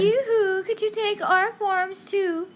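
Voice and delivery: put-on coy girls calling him over; sing-song call.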